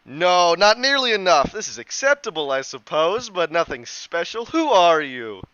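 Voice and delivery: loud, unimpressed otherworldly being; haughty spirit. Acted. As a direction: sniff at the offering, then demand a name. Spirit judges the feast.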